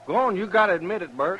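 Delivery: fast, loud and high, pressing him; heated accusation. Acted. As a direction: push him hard to confess. Accusing the trail boss heatedly.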